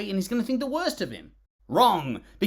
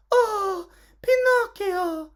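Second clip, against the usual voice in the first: much higher and louder, a slow drawn-out swooning cry; hammed-up damsel gasping his name; over-the-top lovestruck cry, stretched out; mock swooning girl; 6 dB louder, much slower than usual. Mocking love interest's only line.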